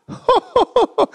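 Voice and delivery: high choked laugh-cry; teary laugh-sob. Retold or imitated. Father breaking down at the gift, high laughing sob. Dad starts crying.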